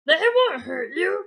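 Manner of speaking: ordinary delivery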